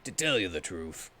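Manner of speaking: ordinary talk, nothing marked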